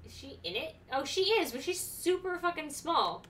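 ordinary talk

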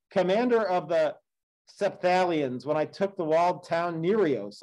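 steady, measured read-aloud of an epic; story narration. A narrator's reading. Teacher reading the Odyssey to the class, measured storytelling.

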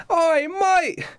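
high, far-off Cockney pirate captain, friendly but bossy; the captain calls out to his new recruit, high and mocking. GM as pirate captain greeting a fisherman, high cheeky voice.

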